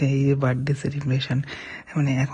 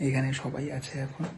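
hushed, much softer voice; speaking softly; 9 dB quieter than usual. Real, not acted. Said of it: "আস্তে আস্তে কথা বলছি".

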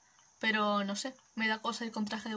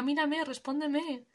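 normal talking voice

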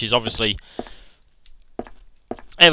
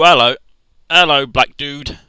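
louder jittery hello; loud nervous greeting; 7 dB louder than usual. Real, not acted. Player greets a lurking figure, loud and nervous. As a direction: greet the scary figure too loudly.

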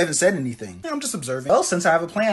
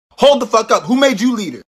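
loud, higher challenge; indignant; 6 semitones higher, 8 dB louder than usual. Acted. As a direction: call out the fake leader loudly. Challenging self-made leader.

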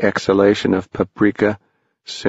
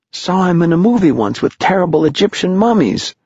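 put-on excited boy voice; 10 semitones higher, faster than usual. A narrator's reading. Henry Hank continues.

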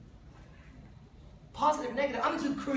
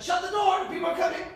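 hurried warning, higher pitch; urgent old man; 8 semitones higher than usual. Retold or imitated. Old man telling princess to shut the door, urgent higher voice. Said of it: "And he's like".

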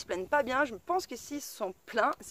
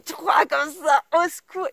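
loud, high comic disbelief; mock alarm; 5 semitones higher, 8 dB louder, slower than usual. Driver learning there are three of them, loud mock alarm. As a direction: cry out as if begging for rescue. Shocked reaction to a third daughter.

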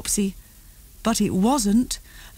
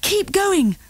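puffing; 10 semitones higher than usual. A narrator's reading. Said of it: "puffed Mummy".

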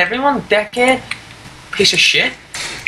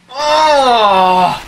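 sudden loud shocked cry, pitched up; surprised shout; 5 semitones higher, 4 dB louder than usual. Real, not acted. Gamer reacts to sudden kill.

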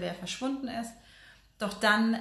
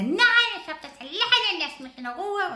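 higher, grumpy little dwarf voice; squeaky grumpy dwarf, pitched up and pushy; grumbling dwarf; 10 semitones higher, 6 dB louder than usual. Retold or imitated. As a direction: snap back like a cranky dwarf who refuses help. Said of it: "und meckert so richtig vor sich hin".

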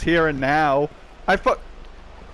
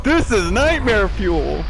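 normal talking voice

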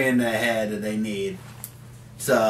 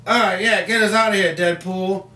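higher-pitched mock reply; lightly voiced comic character; 6 semitones higher than usual. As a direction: lightly act out a comic-book line. Acting Patterson's line in comic joke.

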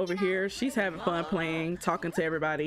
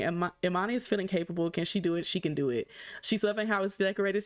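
usual speaking voice

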